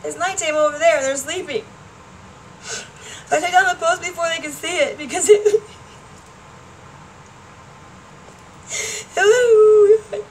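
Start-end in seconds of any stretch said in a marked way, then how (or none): 2.6-10.2 laughing while crying. Real, not acted. Laughing through tears recounting hurt.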